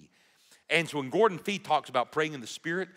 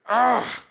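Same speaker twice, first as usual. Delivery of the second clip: frustrated groan; 8 semitones higher, 7 dB louder than usual. Shown as a demonstration. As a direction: groan out loud like you're frustrated. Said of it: "I'm frustrated".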